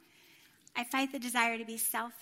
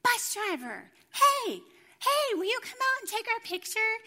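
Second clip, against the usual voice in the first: high, loud calling to get someone's attention; imitated shouting; 10 semitones higher, 5 dB louder than usual. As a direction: act out yelling for a bus driver. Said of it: "then pretty soon she starts shouting".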